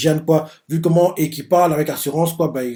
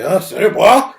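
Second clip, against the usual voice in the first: high, loud "quoi?" in mock shock; imitating a stunned general, rising sharply; shocked disbelief; 5 semitones higher, 6 dB louder, slower than usual. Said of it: "Eki est choqué".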